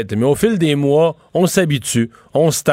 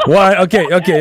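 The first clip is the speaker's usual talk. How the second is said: laughing. Real, not acted. Host laughs at the comparison.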